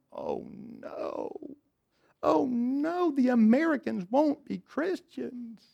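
high, drawn-out mock wail after a hushed "oh no"; fretting God, hammed up; mock panic. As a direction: wail it in exaggerated dismay.

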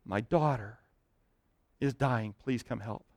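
whisper-close begging for his daughter; desperate father begging Jesus, softly; quiet desperate plea. Retold or imitated. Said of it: "he desperately falls at his feet, and he says".

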